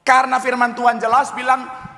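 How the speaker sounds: high-pitched, forceful pulpit cry; emphatic preaching. Real, not acted. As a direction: proclaim it to the whole congregation. (khotbah lantang bernada tinggi)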